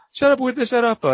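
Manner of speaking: high-pitched mock Italian accent; imitated Italian yell. Neighbor yelling back in a retold cartoon, mock Italian yell. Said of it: "and then someone yells".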